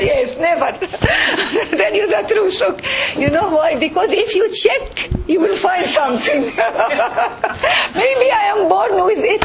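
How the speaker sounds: high-pitched, laughing confession. Real, not acted. Doctor telling sisters she never gets checkups, laughing. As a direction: defend yourself through real bursts of laughter.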